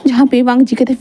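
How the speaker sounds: ordinary delivery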